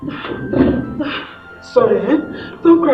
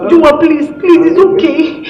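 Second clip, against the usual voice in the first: loud, raised plea; anxious pleading; 5 semitones higher, 6 dB louder than usual. Acted. Reassuring a girl in pain, anxious pleading. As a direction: plead urgently to settle her.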